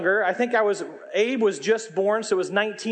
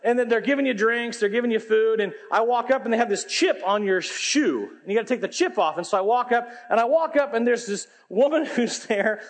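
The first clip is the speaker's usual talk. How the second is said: ordinary talk, nothing marked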